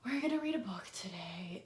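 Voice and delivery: a flat, hushed, bored mutter; dull whisper. Shown as a demonstration. Teacher shows how not to present a book. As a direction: mumble it without any interest. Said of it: "if you present it like".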